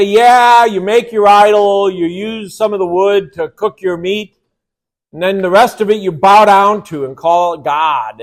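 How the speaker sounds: God mocking Israel with loud sarcasm; sarcastic. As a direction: sneer at the idol makers. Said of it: "He's gonna get sarcastic with them".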